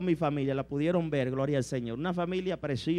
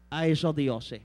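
ordinary delivery

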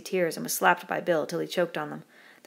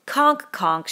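loud, higher scornful shout; 5 semitones higher, 9 dB louder than usual. A narrator's reading. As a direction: shout it down dismissively. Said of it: "shouted Jack".

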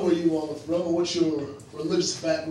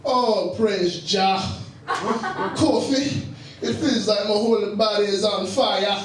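put-on stoned Rasta-style Jamaican accent; hammed-up Jamaican patois lilt; mock Jamaican accent; slower than usual. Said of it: "stop talking like that".

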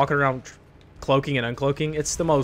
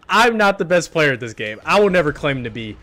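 ordinary delivery

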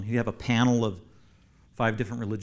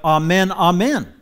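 "amen, amen" loud and high, the second higher; doubled emphatic amen; 6 semitones higher, 11 dB louder, slower than usual. Shown as a demonstration. Teacher showing how repetition compounds, emphatic "amen, amen". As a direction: hammer both words of "amen, amen". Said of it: "made it emphatic all the more".

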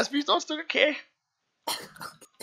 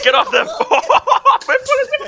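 loud, high laughing yell; laughing shout; 8 semitones higher, 11 dB louder, much faster than usual. Real, not acted.

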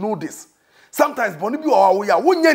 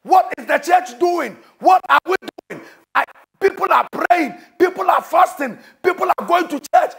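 preaching at full force; 6 semitones higher than usual. Acted. Preacher shouting at the congregation about the failing church, full force.

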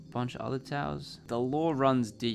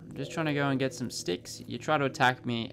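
usual speaking voice

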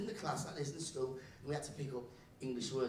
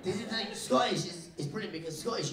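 usual speaking voice

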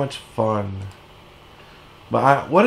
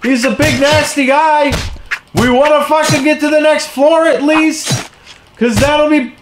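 high-pitched scolding yell; exasperated rant; 11 semitones higher than usual. Real, not acted. Player scolds minions for breaking barrels, exasperated high yelling. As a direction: berate them high and strained, exasperated.

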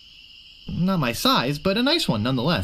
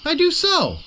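put-on much higher, louder childish retort; petulant insistence; 12 semitones higher, 3 dB louder than usual. Guybrush insisting to the swordmaster, childish and high. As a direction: whine it back like a kid. Childish back-and-forth quarrel.

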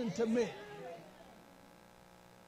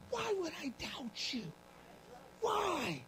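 soft pleading question; 5 semitones higher, 7 dB quieter, slower than usual. Acted. Asking God softly.

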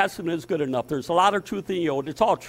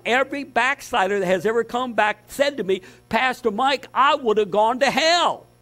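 rising, louder sermon list hammered out; fiery preaching; 8 semitones higher, 5 dB louder than usual. Acted. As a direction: preach it hard, build the list. Preaching builds again.

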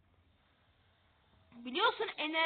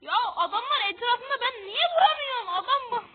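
high, loud exasperated yell; frustrated yell; 8 semitones higher, 8 dB louder than usual. Real, not acted. Gamer yelling at enemies that won't die, high and loud. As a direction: shout at the game in disbelief.

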